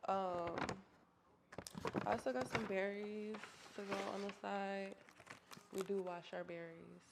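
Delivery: usual speaking voice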